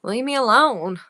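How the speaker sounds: mock a Southern old lady's protest; teasing caricature of Southern speech; imitated Southern accent. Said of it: "You sound like that in my head".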